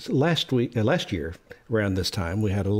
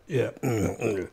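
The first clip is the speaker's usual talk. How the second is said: ordinary delivery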